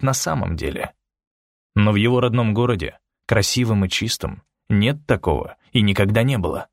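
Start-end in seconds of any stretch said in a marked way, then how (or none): none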